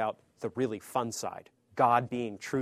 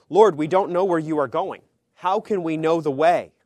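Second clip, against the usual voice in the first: louder, pleading disciples' question; confused disciples; 4 semitones higher, 11 dB louder than usual. Acted. Preacher voicing the puzzled disciples asking Jesus, louder and pleading. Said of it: "And his disciples don't get it".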